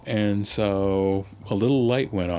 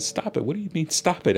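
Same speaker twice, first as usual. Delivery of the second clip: mock indignant kid, pitched up sharply on the comeback; indignant protest; 10 semitones higher, faster than usual. Schoolboy answering the teacher who told him to stop, indignant. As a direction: protest higher, as a wronged schoolboy retold.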